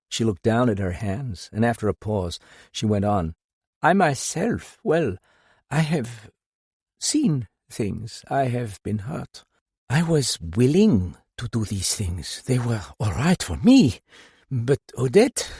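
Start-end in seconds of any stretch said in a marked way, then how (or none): 3.8-15.5 higher grieving mother. A narrator's reading. Madame speaks of her hurts.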